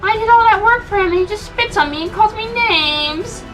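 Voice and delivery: sobbing complaint. A narrator's reading. Pepper crying to the disembodied voice, high sobbing. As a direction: sob out the grievance.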